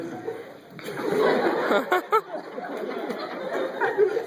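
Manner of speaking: real amused laugh. Real, not acted. Laughing at own story.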